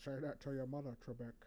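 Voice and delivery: lightly voiced Scottish star; a hint of a Connery-style Scottish accent; Sean Connery. Taunting Trebek in a light Connery impression. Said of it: "just the way he says it".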